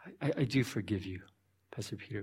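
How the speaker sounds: low, hushed acted reply; softly and hesitantly grant forgiveness; quiet forgiveness.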